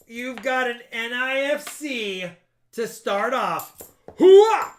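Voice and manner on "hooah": high, loud military hooah; loud gung-ho soldier. Acted. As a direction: bark it out like a soldier. Gung-ho cheer.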